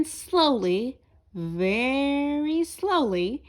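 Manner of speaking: slow, drawn-out narration; very slow careful telling; slowly. A narrator's reading. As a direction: stretch the words out slowly. Narrator slows with the words.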